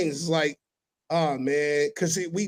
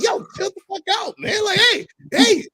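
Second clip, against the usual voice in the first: retold yell; 7 dB louder than usual. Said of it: "I'm like".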